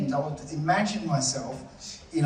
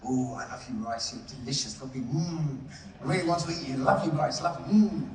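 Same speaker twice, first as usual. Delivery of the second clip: doting coo; 4 semitones lower than usual. Retold or imitated. Telling the class how to talk to the love jar, lower doting coo. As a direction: coo at the jar like it's a sweetheart. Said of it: "jar and go".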